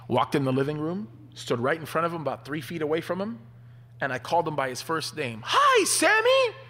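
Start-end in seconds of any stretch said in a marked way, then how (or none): 5.5-6.6 put-on high, slow sing-song call of a kid; cheeky kid greeting. Boy greets his father by first name, high sing-song call.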